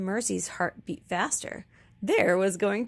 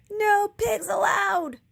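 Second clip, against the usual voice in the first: higher, louder, slow shout; 11 semitones higher, 6 dB louder, slower than usual. A narrator's reading. Eugenia shouting while chasing the pig, slow shout. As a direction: drive the pig away with a yell. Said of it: "Eugenia shouted".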